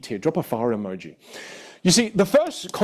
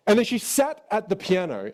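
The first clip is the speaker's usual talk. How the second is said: normal talking voice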